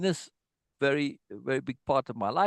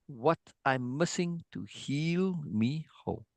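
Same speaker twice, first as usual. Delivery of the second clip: slow soft recital; 4 dB quieter, slower than usual. Shown as a demonstration. Teacher recites lyric softly.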